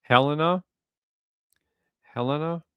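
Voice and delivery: Helena as HEL-en-a, stressed on the first syllable; other pronunciation. Shown as a demonstration. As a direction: try out Helena as HEL-uh-nuh, questioning rise. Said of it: "the other way that she said before".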